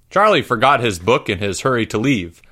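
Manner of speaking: usual speaking voice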